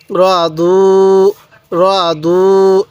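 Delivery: র দু read very slowly, with দু held one alif long; slow drawn-out recitation. Shown as a demonstration. Teacher showing students how to read র দু, slowly drawn out. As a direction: draw out র দু, holding the দু vowel. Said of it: "এরকমভাবে শিখব".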